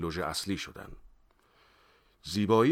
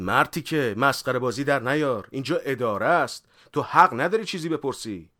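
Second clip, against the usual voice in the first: high, loud official reprimand; angry shout; 6 semitones higher, 8 dB louder than usual. A narrator's reading. Officer scolds suspect for clowning in the office, shouting. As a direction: bellow a scolding at the detainee. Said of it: "مأمور همراه من داد زد".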